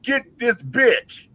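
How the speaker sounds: slow menacing order. Retold or imitated. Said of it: "I said".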